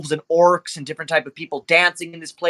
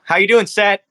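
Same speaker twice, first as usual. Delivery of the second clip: lightly raised, guarded greeting; a touch of strained host; 6 semitones higher, 4 dB louder than usual. Acted. Club owner greeting Seth, slightly raised and tense. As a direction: hide the tension under politeness. GM voices Nero Pink.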